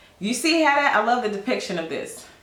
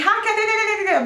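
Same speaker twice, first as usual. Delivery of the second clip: bright mock hi; 12 semitones higher than usual. Mock greeting up in someone's face.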